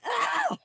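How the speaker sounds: a short startled cry; startled yelp. Retold or imitated. When the creature lunged at the truck, a high yelp. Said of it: "and I went".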